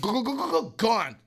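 high, rapid gulping noises; comic glug-glug chug; imitated gulping. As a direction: mimic chugging a drink to the last drop.

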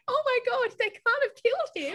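mock distress. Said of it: "now you're all like".

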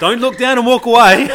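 loud, high-pitched challenge through laughter; rousing call. Real, not acted. Challenging the listeners to greet strangers, rousing loud call. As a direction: urge them on at full voice.

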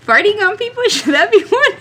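giggling through the words; high-pitched laugh while talking. Real, not acted. Laughing while answering question.

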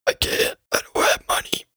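voiceless deathbed rasp; Prince Philip dying. Acted. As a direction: gasp it out with your last breath. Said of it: "Prince Philip on his dying bed".

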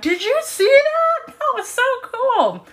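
high-pitched excited gush; reliving an amazed squeal of a question; excited retelling. Retold or imitated. Telling mates about the whale sighting, high and excited.

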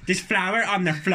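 slow, loud Northern Irish impression; Northern Irish accent. Shown as a demonstration. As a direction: lay the Northern Irish on thick. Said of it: "you got the Northern Irish".

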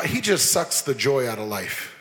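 low, soft grumble of a complaint; a mild, downbeat mock complaint; gloomy mock complaint. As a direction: mutter it like a fed-up gossip. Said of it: "you've heard the term".

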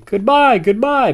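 high, loud, joyful farewell call; excited singsong send-off; calling goodbye. A narrator's reading. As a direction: call out while sailing away. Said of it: "Benny called".

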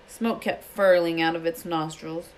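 plain reading voice

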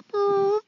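a tiny high-pitched boop for the fart; mock fart noise, very high; high squeaky fart noise. Imitating a fart sound.

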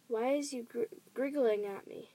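plain reading voice

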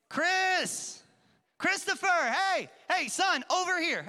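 high, loud call across the store; dad calling out. Retold or imitated. Dad spotting his lost son in the store, calling loudly. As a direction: call the kid's name over the crowd. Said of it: "I heard a voice calling my name".

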